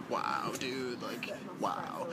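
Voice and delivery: deadpan stunned under breath; stunned wow. Retold or imitated. Stunned reaction to threats.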